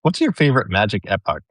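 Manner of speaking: ordinary delivery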